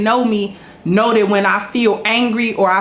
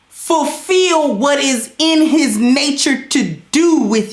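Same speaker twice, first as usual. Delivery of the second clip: higher, slow, drawn-out sermon voice; preaching to the ladies; 5 semitones higher, slower than usual. Acted. Streamer lecturing the ladies in chat, slow higher preaching. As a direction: lay it down like a preacher, word by word.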